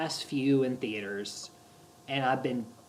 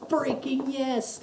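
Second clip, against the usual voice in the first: high, drawn-out elated cry; retold ecstatic fan cheer; screaming in excitement; 11 semitones higher, much slower than usual. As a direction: cheer it in high-pitched triumph. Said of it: "screaming at the top of my lungs".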